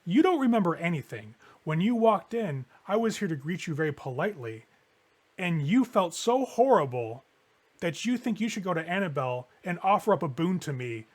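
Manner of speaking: haughty, measured reproach; pointed rebuke. Acted. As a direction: lean hard on key words, drawing them out. Sun pointedly rebukes James, demanding recompense.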